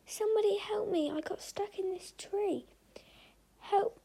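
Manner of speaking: high, slowed, desperate calls; a high, drawn-out cry for help; yelling for help. A narrator's reading. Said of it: "yelled the monkey".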